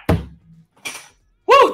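loud, high woo; high celebratory whoop. Real, not acted. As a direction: let out a joyful cheer. Celebrating end of segment.

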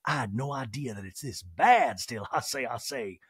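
put-on talking-head impression; mock-concerned character voice; Sutton. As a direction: imitate a fussy interview aside. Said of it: "Sutton in a talking head goes".

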